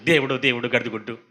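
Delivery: mock casual oath దేవుడు, దేవుడు said lightly and low; careless swearing. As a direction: toss off దేవుడు, దేవుడు like a habit. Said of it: "ఎంతసేపు చూసాం". (దేవుడు, దేవుడు అని తేలికగా ఒట్టు)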